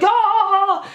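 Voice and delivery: high excited shriek; a short squeal of delight; girlish scream. Retold or imitated. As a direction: scream like a little girl at Christmas. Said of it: "screamed like a little girl".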